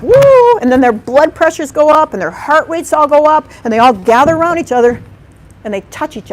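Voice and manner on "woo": high, loud alarmed hoot; startled hoot. Retold or imitated. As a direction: mimic the frightened primates. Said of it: "they all, like".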